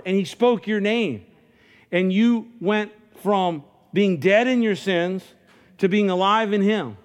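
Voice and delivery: full-force preacher voice; fervent preaching. Acted. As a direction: preach it with rising fire.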